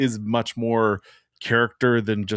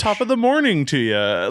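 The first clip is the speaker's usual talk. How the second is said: put-on a light, brief stab at an Irish accent; 7 semitones higher, 4 dB louder than usual. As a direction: toss off a quick Irish greeting. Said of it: "I want to hear you attempt an Irish".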